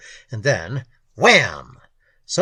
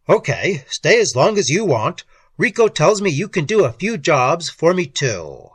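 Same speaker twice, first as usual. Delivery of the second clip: loud, higher booming boss; 3 semitones higher, 6 dB louder than usual. A narrator's reading. Boss offers newcomer lodging and jobs, booming voice. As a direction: lay down terms at full boom. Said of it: "voice that could kill horseflies at five hundred feet".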